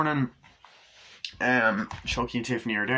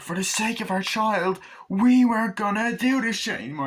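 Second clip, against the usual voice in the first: high, heated pleading; hammed-up raised-voice reproach; mock indignant; 11 semitones higher, 4 dB louder than usual. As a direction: act out a parents' quarrel. Acts the couple's quarrel.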